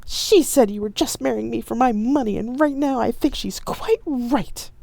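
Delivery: dramatic hurt partner; hurt accusation. Acted. Staged quarrel.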